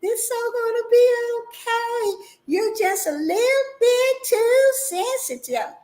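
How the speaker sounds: high, sing-song patronising voice; mock-soothing singsong of a condescending person; mocking condescension. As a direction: sweetly talk down like to a child. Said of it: "pat you on it".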